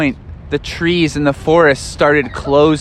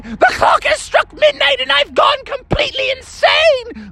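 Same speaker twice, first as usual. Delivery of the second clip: high, maniacal shout; crazed monster voice. Acted. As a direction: cackle it like a madman. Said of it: "and he said".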